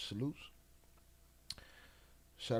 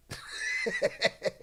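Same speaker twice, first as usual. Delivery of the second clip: high burst of laughter. Real, not acted. Laughs after the story.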